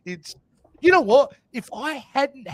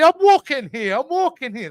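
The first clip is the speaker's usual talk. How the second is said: mock New Yorker; 6 semitones higher, 6 dB louder than usual. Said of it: "they'll be".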